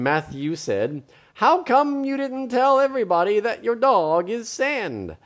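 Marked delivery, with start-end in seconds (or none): 1.3-5.2 high, animated question; puzzled story boy. Acted. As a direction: ask it wonderingly as the boy. Boy in story asks friend.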